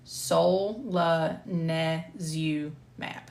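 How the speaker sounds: solanezumab very slow, syllable by syllable; struggling pronunciation. Real, not acted. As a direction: break solanezumab into separate, drawn-out syllables. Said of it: "this is where I struggle".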